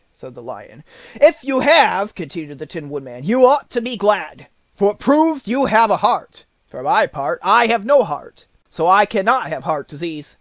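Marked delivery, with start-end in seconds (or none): 1.1-10.3 higher and louder tin man; a cheerful tin man reasoning; bright, raised tin man voice; Tin Woodman voice. Acted. Tin Woodman answers the lion.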